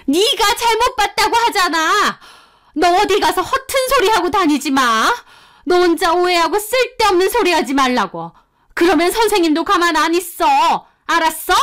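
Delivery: furious teacher pushing back; fierce accusing. Retold or imitated. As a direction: turn on the kid, cornering her. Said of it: "엄청 무섭게 몰아붙이고 따지는".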